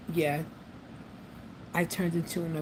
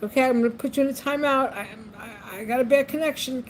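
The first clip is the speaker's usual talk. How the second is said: louder, higher fake excuse to hang up; mock timeout scolding; 6 semitones higher, 5 dB louder than usual. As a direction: brush her off loudly. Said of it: "a couple of times I was like".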